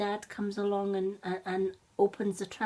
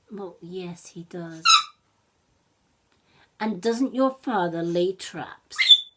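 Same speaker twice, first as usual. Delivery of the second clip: louder, pointed accusing mother wolf; lightly voiced wary mother wolf; suspicious mother wolf; 8 dB louder than usual. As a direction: she knows their father hunts. Mother wolf confronts children.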